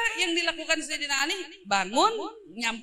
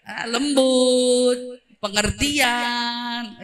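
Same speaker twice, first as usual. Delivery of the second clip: slow, drawn-out sugary praise, getting louder; butter her up so she can't stay angry; sweet-talking flattery; 3 semitones lower, 4 dB louder, much slower than usual. Acted. Worker flattering her angry boss, slow and syrupy.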